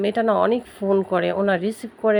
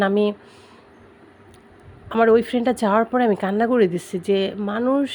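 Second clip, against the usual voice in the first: ordinary talk, nothing marked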